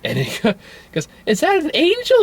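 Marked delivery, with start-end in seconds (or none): 1.3-2.2 put-on very high child voice; squeaky, wide-eyed question; little girl. Girl asks Joe about the angel, little girl voice. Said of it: "Or the little girl finds".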